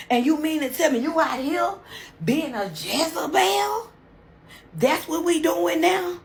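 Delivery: hammed-up betrayed shout; angry outburst. Fiance yelling at his cheating bride, furious.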